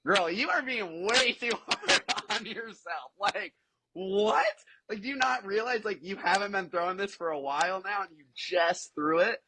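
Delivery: high, incredulous coach's protest; acting out an exasperated, hyped-up pep talk; pumped up. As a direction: scold affectionately in disbelief, stretching key words. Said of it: "I'm all pumped up".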